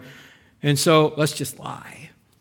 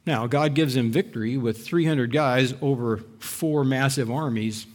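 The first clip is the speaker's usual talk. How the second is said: usual speaking voice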